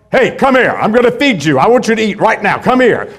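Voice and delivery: loud, gruff barked orders to a dog; harsh tone. Shown as a demonstration. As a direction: bark it so the dog gets nervous. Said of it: "It's the tone, not just the words".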